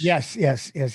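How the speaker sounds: ordinary talk, nothing marked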